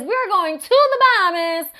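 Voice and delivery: high and loud, bossy friend; Carla's insistence. Retold or imitated. Carla insisting to Julie about the trip, high and loud. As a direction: belt it out like the pushy friend. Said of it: "But Carla says".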